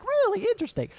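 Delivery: sky-high mock fascination; mock interest. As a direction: feign being fascinated in a squeaky high voice. Jesus is like.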